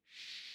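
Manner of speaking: soft unvoiced shh; child's quiet shush. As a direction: hush someone. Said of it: "and she goes".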